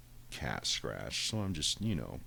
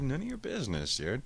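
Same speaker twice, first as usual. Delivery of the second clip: higher put-on snooty cat; sassy cat voice; 9 semitones higher than usual. Cat dismissing his owner, high sassy voice. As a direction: brush him off like a cheeky pet.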